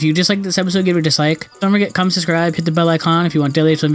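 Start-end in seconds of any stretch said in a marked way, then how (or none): none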